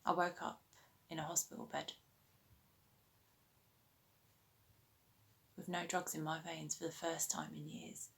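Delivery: hushed, halting delivery with long pauses; subdued, pained recollection. Acted. An addict recalling waking in hospital without drugs, subdued. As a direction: let each painful phrase hang, softly.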